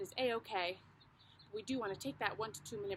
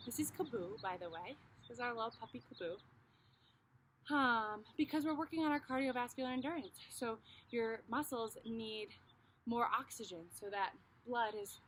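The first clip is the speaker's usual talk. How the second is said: ordinary delivery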